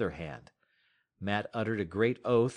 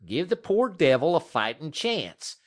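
higher, louder rough frontier man pleading hard; a dog musher arguing, pitched up and loud; Matt's rough voice; 8 semitones higher, 5 dB louder than usual. Acted. Dog musher pleading with Scott for the dog, raised and loud.

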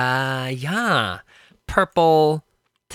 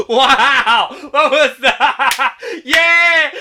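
laughing shout; 7 semitones higher, 6 dB louder, much slower than usual. Real, not acted. Laughing at partner's odd word.

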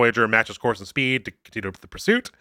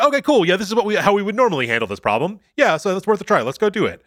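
mock upbeat crew; 7 semitones higher, faster than usual. Crew mock-cheerful, imitated.

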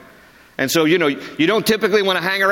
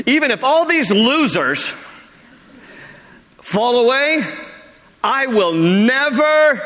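cocky Peter; 7 semitones higher, slower than usual. Retold or imitated. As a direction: sneer at the others and boast. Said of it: "this is a loose translation".

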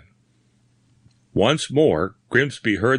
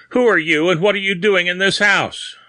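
high, forceful questioning; cold demand; 9 semitones higher, 4 dB louder than usual. A narrator's reading. Bearded man confronting a stranger in the house, cold demand. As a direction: challenge the stranger, voice raised in pitch. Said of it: "he said coldly".

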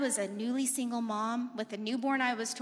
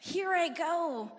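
higher, thrilled yes with a slow here I go; excited yes; 6 semitones higher, much slower than usual. Retold or imitated. Young woman celebrating her Naval Academy acceptance, higher and thrilled. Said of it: "I was like".

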